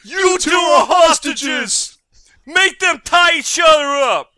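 high, loud robber yell; bank robber. Acted. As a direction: bark it like a robber holding up a shop. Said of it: "says the lead robber".

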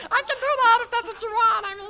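high, loud put-on voice; other silly voice. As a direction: crank the put-on voice way up. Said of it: "It's either that or this voice".

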